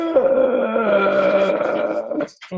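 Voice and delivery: long, drawn-out exasperated sound; acted groan of overwhelm. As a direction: let out a long overwhelmed noise. Mimes being overwhelmed.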